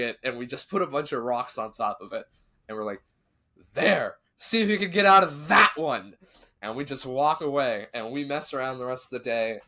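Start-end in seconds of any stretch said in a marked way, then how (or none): 3.7-6.2 taunting shout. Retold or imitated. Kids burying doll, taunting.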